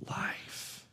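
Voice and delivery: breathy whisper on "life"; whispered plea. Acted. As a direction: hush the word "life" to a whisper. Moses pleads, choose life.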